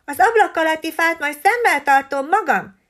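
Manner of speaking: high, loud bragging squawk; boasting parrot. A narrator's reading. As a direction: brag like you own the tree. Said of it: "kérkedett".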